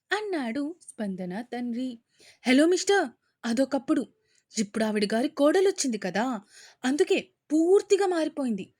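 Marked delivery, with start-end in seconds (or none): 2.4-8.7 furious daughter mocking her aunt to her father, loud; angry rant. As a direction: snap back at dad, loud and scornful.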